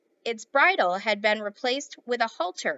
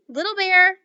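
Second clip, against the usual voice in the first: higher, louder call of a name; 8 semitones higher, 8 dB louder than usual. Acted. Boy calling to his little figure, raised voice. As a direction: call to wake him. Omri calling the toy Indian.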